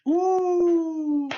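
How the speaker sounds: high, loud impressed ooh; a big approving ooh. Real, not acted. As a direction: coo at the wrestler pick. Reacting to Tommy End pick.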